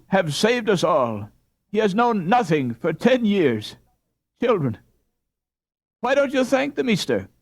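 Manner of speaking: high, loud, breathless gratitude; overjoyed. A narrator's reading. Overjoyed wife thanking the doctor who saved them. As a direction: pour out joy and thanks.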